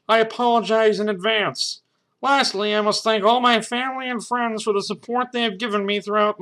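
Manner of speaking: high, loud puppet voice reading aloud; put-on high puppet farewell, easing at the end; Ernie character voice. Ernie writing his farewell diary note, high puppet voice. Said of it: "Ernie was writing in a diary. It said".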